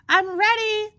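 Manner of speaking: loud high shout. Acted. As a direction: call up to the roof. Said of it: "the lady below shouted out".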